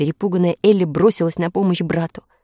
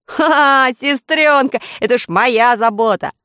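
high, loud, cocky brother's voice; confident boy; 6 semitones higher, 5 dB louder than usual. A narrator's reading. Brother boasting to little sister, loud confident reply. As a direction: brush the worry off with a loud ha-ha.

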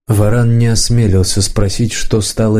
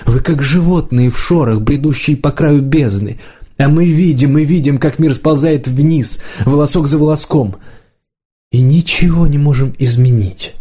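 higher-pitched, impassioned lament; anguished Подставка; 5 semitones higher than usual. Acted. Подставка telling Варан of a world sliding down, anguished. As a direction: let the pitch climb with helpless urgency.

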